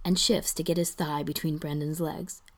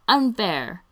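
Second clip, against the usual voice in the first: loud, raised, falling protest; calling out; 4 semitones higher, 7 dB louder than usual. A narrator's reading. Ian protests to the kissing couple, calling out. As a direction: call it across the room in complaint. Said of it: "Ian calls out".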